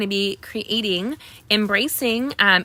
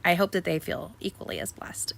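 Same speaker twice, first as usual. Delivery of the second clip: whisper; 2 semitones lower, 5 dB quieter than usual. Real, not acted. Soft aside about her team.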